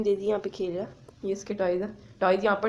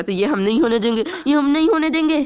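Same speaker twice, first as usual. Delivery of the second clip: playful firmness; 3 semitones higher, 8 dB louder than usual. Real, not acted. Teasing dog.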